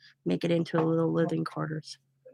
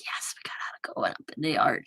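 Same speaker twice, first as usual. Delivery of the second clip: hushed, sneaky whisper of relief; relieved whisper; 5 semitones lower, 7 dB quieter than usual. Retold or imitated. Mom quietly cheering she skipped the arch, whispering. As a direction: whisper it like a secret win.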